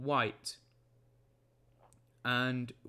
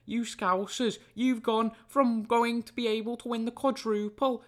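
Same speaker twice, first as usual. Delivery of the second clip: mocking Scouse accent, higher and louder; jeering Scouse impression at rival fans; 8 semitones higher, 6 dB louder than usual. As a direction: taunt Liverpool fans in a put-on Scouse accent. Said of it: "this is my Scouse accent".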